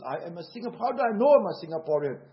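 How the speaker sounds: usual speaking voice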